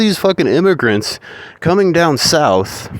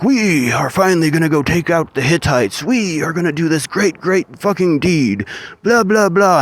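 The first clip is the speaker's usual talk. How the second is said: patriotic rally speech; 6 semitones higher than usual. Retold or imitated. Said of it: "they do their patriotic".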